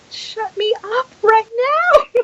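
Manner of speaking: high, laughing plea. Real, not acted. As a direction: beg through laughter. Laughing while retelling plea.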